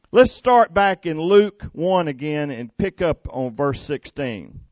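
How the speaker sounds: ordinary talk, nothing marked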